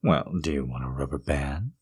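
soft whispered offer; whispering. Acted. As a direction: ask in a hush.

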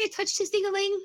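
high-pitched mock horror; hammed-up high freak-out; mock panic. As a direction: squeal it in playful embarrassment. Co-host echoes the embarrassed panic.

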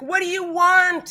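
high, loud upset outburst; upset demand. Acted. Player's character confronts the ichor, upset and loud. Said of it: "starting to feel really just upset".

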